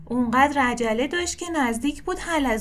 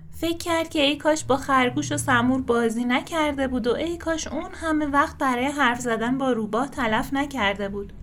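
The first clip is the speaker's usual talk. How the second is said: usual speaking voice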